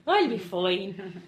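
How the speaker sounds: put-on very slow, drawn-out Irish-ish accent; shifty accent. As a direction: stretch it into a shaky Irish accent. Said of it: "my shifty accent".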